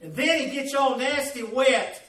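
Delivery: usual speaking voice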